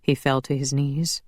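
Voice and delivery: ordinary delivery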